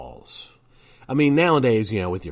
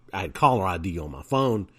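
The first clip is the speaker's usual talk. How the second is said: ordinary delivery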